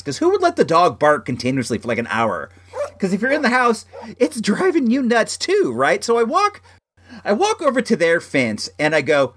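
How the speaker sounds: ordinary delivery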